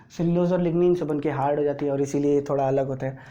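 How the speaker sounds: ordinary talk, nothing marked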